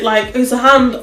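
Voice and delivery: usual speaking voice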